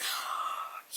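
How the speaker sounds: soft, breath-sucking gasp of excitement; excited gasp. Real, not acted. Reviewer overwhelmed by her new palette, excited gasp. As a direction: suck in air in awe.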